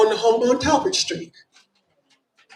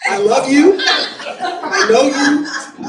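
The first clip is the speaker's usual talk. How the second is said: higher, louder, laughing as she spots a friend; affectionate laughing greeting; 7 semitones higher, 8 dB louder, much slower than usual. Real, not acted. Speaker spots a friend in the crowd, laughing and louder. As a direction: beam it out with a laugh.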